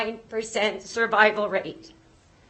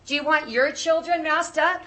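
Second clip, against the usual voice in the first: ordinary talk, nothing marked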